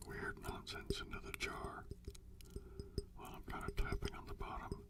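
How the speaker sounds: soft unvoiced whisper, close to the mic; ASMR whisper. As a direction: breathe the words gently for the listener.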